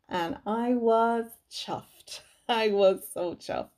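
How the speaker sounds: slow, laughing delight. Real, not acted. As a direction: giggle through being chuffed. Delighted about shelving gift.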